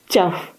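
single short imitated yap; puppy yap. A narrator's reading. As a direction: yap once in reply. Puppy yaps back.

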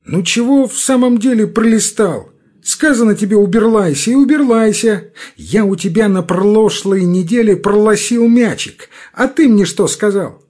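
пролистал, уберлайся, пролосил with l for r; burry r in уберлайся. A narrator's reading. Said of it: "своим картавым голосом".

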